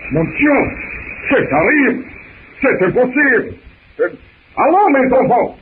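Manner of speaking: put-on high, excited French accent; rally the crew in a high French accent; excited French captain.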